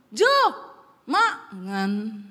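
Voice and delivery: comic forced drop on "makan"; falling end tone. Shown as a demonstration. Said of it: "Turun langsung".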